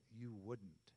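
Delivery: low, soft, under-the-breath reply; hushed, low-pitched reenacted objection; quiet retort to Jesus. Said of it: "I said it quietly".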